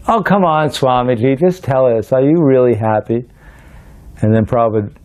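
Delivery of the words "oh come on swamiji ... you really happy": high opening, coaxing all through; exasperated, higher-pitched retold plea; insistent pleading. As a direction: imitate a boy begging for a straight answer. Boy presses again in retold story.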